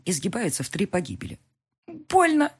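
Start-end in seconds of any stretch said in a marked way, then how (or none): 1.9-2.5 higher crying out it hurts; pained cry. A narrator's reading. Elvira crying out in pain, pained. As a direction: wail from the pain.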